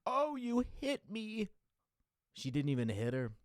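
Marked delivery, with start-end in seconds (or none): 0.0-1.5 high, slow whiny mock Karen; a shrill put-on Karen complaint. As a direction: play the wronged Karen, hammed up and squeaky. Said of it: "the Karen's like".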